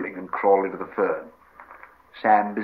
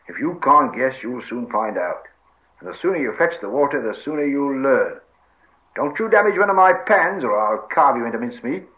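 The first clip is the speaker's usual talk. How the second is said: louder scolding, building to a threat; gruffly bossy Sam; 4 semitones higher, 6 dB louder than usual. Acted. Sam warns Gollum about his pans, scolding. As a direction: warn him off, rising in force.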